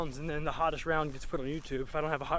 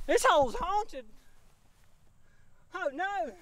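much higher, put-on silly voice; 13 semitones higher, 5 dB louder, slower than usual. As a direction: squeak it out like a goofy cartoon character. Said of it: "Wouldn't it be funny if that's how I talk?".

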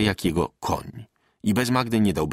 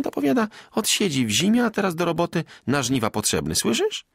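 higher, excited village woman; peasant wife Magda, eager; 8 semitones higher than usual. A narrator's reading. Magda telling Bartek news, eager and high.

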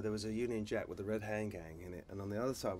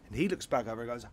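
ordinary delivery

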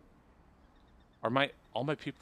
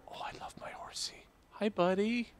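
cooing to horse; 10 semitones higher than usual. Real, not acted. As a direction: coo tenderly at a pet horse.

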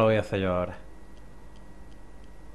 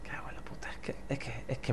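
whispered curse; 13 dB quieter than usual. Real, not acted. Muttering while concentrating.

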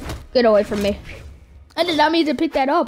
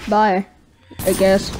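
usual speaking voice

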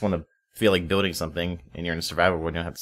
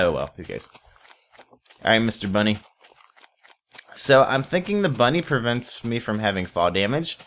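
usual speaking voice

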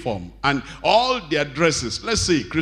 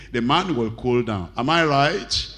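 ordinary talk

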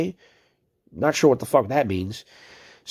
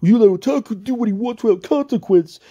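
mocking his words in a higher put-on voice; mocking quote; 6 semitones higher, 3 dB louder than usual. Streamer mocking a rival's old message, sneering voice. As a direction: parrot him back with a sneer. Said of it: "you were literally sitting here saying".

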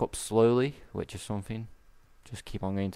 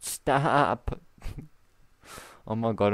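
normal talking voice

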